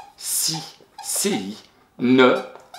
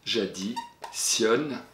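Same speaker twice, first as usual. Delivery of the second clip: J'additionne drawn out slowly and softly; slow spelling-out; 3 semitones lower, 8 dB quieter, much slower than usual. Shown as a demonstration. Teacher slowly spelling J'additionne for her pupil, soft and drawn out. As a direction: stretch J'additionne out, syllable by syllable. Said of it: "deux D à l'additionne".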